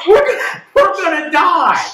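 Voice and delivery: high, loud, laughing fake terror; mock panic. Co-host joking the Joker's whiskey will kill them, laughing mock panic. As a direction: wail it, laughing at your own doom.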